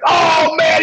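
high, shouted burst of joy; proud dad hearing his son got called up; excited dad. Retold or imitated. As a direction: cry it out like a thrilled father. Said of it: "he was so excited".

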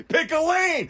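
high, slow exasperated yell; mock frustrated driver, drawn out; dad stuck in traffic. Mocking the road rage line.

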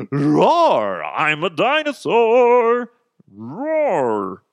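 loud, high, drawn-out roaring; hammed-up dinosaur roar; pretend dinosaur. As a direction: bellow it like a silly dinosaur. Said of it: "I'll pretend to be a fucking dinosaur".

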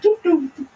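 high cartoon doops; high-pitched doop sounds. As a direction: squeak out the doops. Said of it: "see Chris Pratt be like".

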